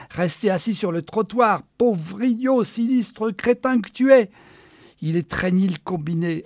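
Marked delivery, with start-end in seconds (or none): 0.1-4.3 building from plain to high, loud scolding; angry self-berating. Acted. Man scolding himself for dawdling, building anger. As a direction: tear into yourself harder and harder.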